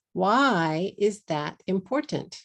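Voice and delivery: normal talking voice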